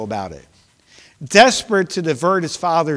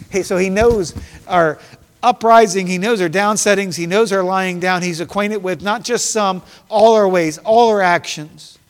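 louder, higher preaching with rising fervor; building preaching; 4 semitones higher, 6 dB louder than usual. Acted. Preacher rousing the church about God knowing every word, fervent. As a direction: drive the verses home to the congregation.

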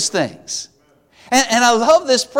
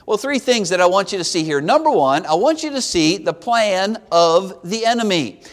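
ordinary talk

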